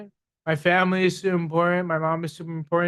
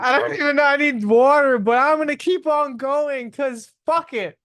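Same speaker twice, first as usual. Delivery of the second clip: strained, higher voice through hiccups; 7 semitones higher, 4 dB louder than usual. Real, not acted. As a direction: push on despite the hiccups. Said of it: "How the fuck you have hiccups".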